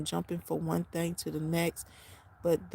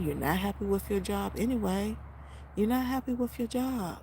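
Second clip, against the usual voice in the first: ordinary delivery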